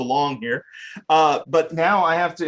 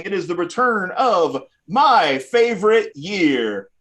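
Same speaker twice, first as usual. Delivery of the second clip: showy game show host build-up; grand announcer voice, slow on the title; announcer intro; 5 semitones higher than usual. Acted. Host launches first game.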